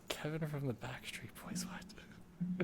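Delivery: soft whispered aside while looking something up; hushed, voiceless muttering; whispering to oneself. Real, not acted. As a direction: whisper it under your breath, then a puzzled question. Muttering a search to himself.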